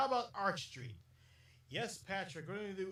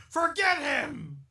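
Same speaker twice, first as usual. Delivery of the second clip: shout; 7 semitones higher, 13 dB louder, slower than usual. Acted. Sudden shout at someone.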